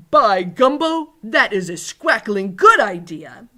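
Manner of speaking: loud, booming giant's approval; delighted giant. A narrator's reading. Giant welcomes the girl's seating idea, loudly delighted. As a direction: exclaim with big, drawn-out delight.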